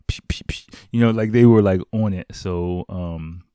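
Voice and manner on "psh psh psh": unvoiced spray bursts, "psh psh psh"; spray sound. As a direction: imitate a spray bottle squirting. Imitating staff spraying disinfectant.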